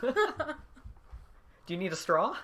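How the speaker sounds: high, slow, sugary service voice, laughing; drawn-out polite cashier offer; customer service voice. Shown as a demonstration. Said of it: "Do your customer service voice".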